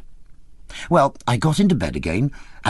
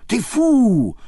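even higher, loud French exclamation; excited cry; 13 semitones higher, 4 dB louder than usual. A narrator's reading. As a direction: repeat it, rising further. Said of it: "She got so excited".

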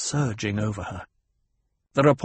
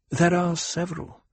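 slightly weary, higher-pitched reply; touch of weariness; 6 semitones higher than usual. A narrator's reading. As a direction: answer with understated weariness. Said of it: "with a touch of weariness".

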